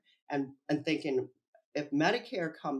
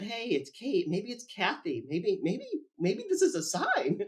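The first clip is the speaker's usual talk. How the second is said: excited realization; 6 semitones higher than usual. Retold or imitated. Recalling spotting Kate's name while googling, rising excitement. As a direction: build up to a thrilled discovery.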